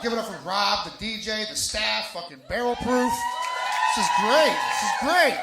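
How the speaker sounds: loud, high-pitched crowd hype; hype shout. Real, not acted. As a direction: whip up the applause, loud and high. Host hyping the crowd for applause.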